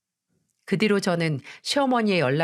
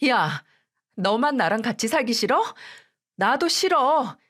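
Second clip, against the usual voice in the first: higher, sharp comeback; wife fighting back at her husband, furious; angry retort; 5 semitones higher than usual. Acted.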